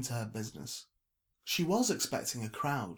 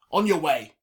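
loud, high, commanding send-off; booming dismissal; curt dismissal; 5 semitones higher, 12 dB louder than usual. A narrator's reading. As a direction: order someone off forcefully. Trevor dismisses the girl.

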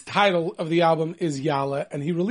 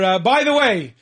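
ordinary talk, nothing marked

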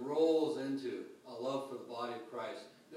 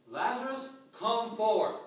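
commanding call; 7 semitones higher, 9 dB louder than usual. Acted. Jesus calls Lazarus from tomb.

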